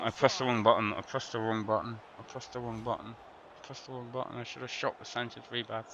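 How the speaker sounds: low, very quiet self-blame; dejected muttering. Real, not acted. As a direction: mumble it over to yourself, fading away. Blaming himself after a miss.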